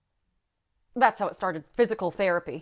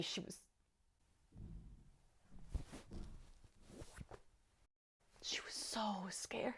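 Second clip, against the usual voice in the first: pained whisper; 20 dB quieter than usual. Acted. Survivor recalling little sister's fear, whispering. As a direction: let it drop to a stunned whisper.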